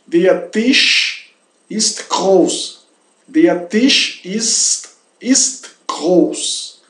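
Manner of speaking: very slow, separated words, long sh in "Tisch"; slow German model. Shown as a demonstration. Teacher modelling "the table is big", slow careful German. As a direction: enunciate each German word for learners to repeat.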